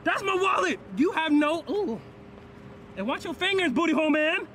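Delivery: high, loud yelps of protest; loud and high, recoiling from the pat-down; loud squealing protest. Real, not acted. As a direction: squeal at the searching hands. Yelping while being searched.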